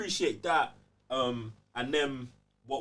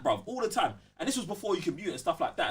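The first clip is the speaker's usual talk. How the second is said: ordinary delivery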